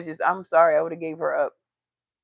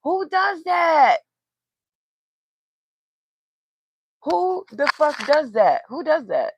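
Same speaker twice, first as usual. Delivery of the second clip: high, slow, disbelieving shout, fading down; real outrage shouted high; outraged shout; 9 semitones higher, 4 dB louder, slower than usual. Real, not acted. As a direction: yell it in disbelief then let it fall. Outraged at mother's threats.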